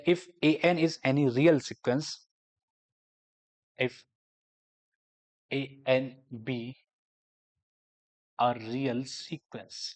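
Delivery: slow, word by word dictation pace. Shown as a demonstration. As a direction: dictate it so students can write it down. Dictating a definition. (धीमे-धीमे लिखवाने वाला अंदाज़)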